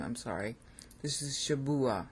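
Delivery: usual speaking voice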